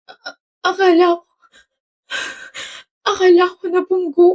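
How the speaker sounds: high-pitched tearful stammer; crying. Acted. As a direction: choke out the words through tears. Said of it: "Bakit ka umiiyak?". (umiiyak, nauutal sa iyak)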